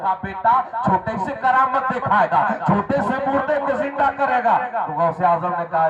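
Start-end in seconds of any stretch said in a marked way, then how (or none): 0.2-4.6 high-pitched acted disbelief; incredulous challenge. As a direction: challenge the child in a raised pitch. Acted grandfather challenging the boy.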